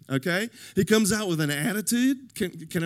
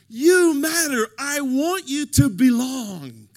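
loud affirmation; 7 semitones higher, 8 dB louder, slower than usual. Retold or imitated. Voicing a longed-for friend calling out loud affirmation. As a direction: belt it out, high and loud.

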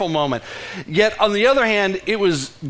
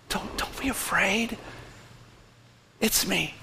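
soft, quiet comfort; Jesus calming John, softly; tender reassurance; 10 dB quieter than usual. Retold or imitated. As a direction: soothe someone who is scared. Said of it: "As Jesus said".

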